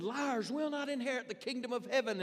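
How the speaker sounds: high, loud fiery preacher's thunder; preaching like a maniac. Retold or imitated. Evangelist warning the crowd, a loud fiery preacher's cry. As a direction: hammer the sinners from the pulpit. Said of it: "began to preach like a maniac".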